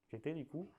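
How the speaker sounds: usual speaking voice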